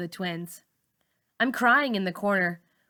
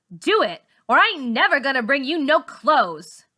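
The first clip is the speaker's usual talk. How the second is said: louder, higher bullying threat; threatening; 6 semitones higher, 7 dB louder than usual. A narrator's reading. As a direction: threaten her, voice raised. Char threatens Malika.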